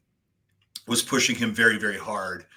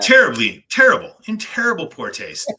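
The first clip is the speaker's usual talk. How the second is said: mock outrage; 9 semitones higher, 9 dB louder than usual. Mocking outrage over shirt.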